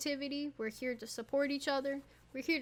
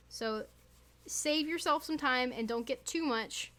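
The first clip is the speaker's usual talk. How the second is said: normal talking voice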